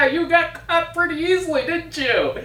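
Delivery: high, cackling hag voice; cackling witch voice. Acted. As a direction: play the green hag, gloating and cackling. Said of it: "and she comes in and says".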